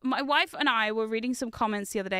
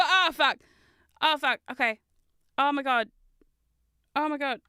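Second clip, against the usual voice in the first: ordinary talk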